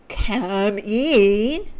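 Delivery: wolf trying to sound gentle; softened wolf voice. A narrator's reading. Wolf disguising himself to lure the girl, softened. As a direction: soften the voice to fool her. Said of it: "The wolf softened his voice".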